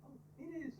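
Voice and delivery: high, quick, soft imitation; mock advert celebrity. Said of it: "then [name] takes it out".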